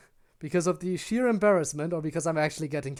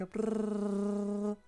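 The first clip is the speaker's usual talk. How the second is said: a long, slow, soft rolled brrrr, much softer; 2 semitones higher, 8 dB quieter than usual. Shown as a demonstration. Streamer showing chat the softer brr she prefers, soft rolled. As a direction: roll it gently and stretch it out. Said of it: "something a little bit softer".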